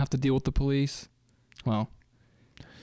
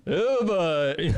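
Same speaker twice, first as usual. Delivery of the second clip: amused dad; 6 semitones higher than usual. Retold or imitated. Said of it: "he's like".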